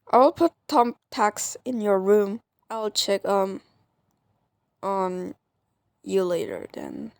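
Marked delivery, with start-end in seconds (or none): none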